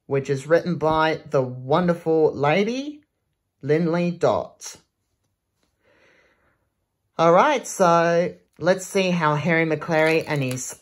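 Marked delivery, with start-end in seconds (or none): none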